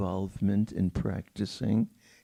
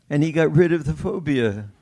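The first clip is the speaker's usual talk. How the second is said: loud, laughing retelling, voice lifted higher; 5 semitones higher, 8 dB louder, faster than usual. Real, not acted. Pianist repeating the happy ending to the audience, laughing loudly. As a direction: burst out laughing as you repeat it.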